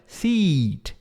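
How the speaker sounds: "Seat" with a long, stretched ee. Shown as a demonstration. Teacher exaggerating the long vowel for learners. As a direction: really pull out the ee in "Seat". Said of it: "Pode, pode puxar mesmo".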